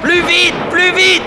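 very high, loud yelling; frantic shout. Acted. Poursuite, les Indiens approchent. (hurlement frénétique)

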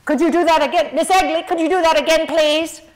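booming request to the stage; big director voice. Retold or imitated. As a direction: project it across a theater at a child. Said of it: "they got a big voice".

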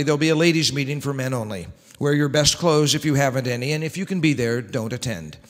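usual speaking voice

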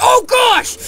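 very high startled shriek. Real, not acted. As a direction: jump with fright. Sudden fright.

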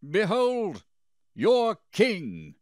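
high, loud, emphatic call; ringing proclamation. A narrator's reading. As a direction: present him at full voice to a crowd. Pilate presents king to crowd.

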